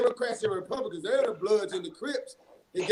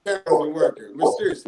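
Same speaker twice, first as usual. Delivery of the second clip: sarcastic; 4 semitones lower, 6 dB louder, faster than usual. Real, not acted. Relative on a radio show mocking the broken camera, loud sarcasm. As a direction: spit out "mysteriously" with biting sarcasm. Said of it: "Mysteriously not working, of course".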